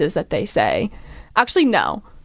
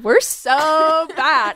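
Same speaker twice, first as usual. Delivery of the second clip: higher, slow drawn-out brag; Valley Girl voice; 6 semitones higher, much slower than usual. Retold or imitated. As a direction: stretch it like a bratty party girl. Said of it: "And they're like".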